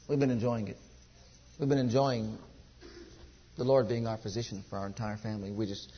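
low, soft, solemn pulpit voice; quiet, earnest testimony with long pauses; hushed preaching. Real, not acted. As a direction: drop right down and confide it to the congregation.

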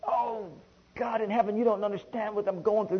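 high, plaintive mock prayer; high-pitched imitation of complaining to God; spewed complaint. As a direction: pour it out as an overwrought plea. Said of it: "just spew out the problem".

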